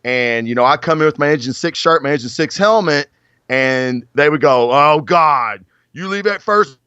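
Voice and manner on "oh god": ordinary delivery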